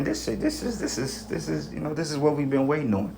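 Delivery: strained disbelief. Retold or imitated. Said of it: "I'm like".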